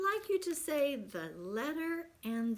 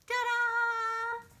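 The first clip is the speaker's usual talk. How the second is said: high, loud, drawn-out ta-da; big held final note; showy fanfare; 12 semitones higher, 10 dB louder than usual. Shown as a demonstration. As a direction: announce it like a magic trick. Teacher demonstrates the D sound playfully.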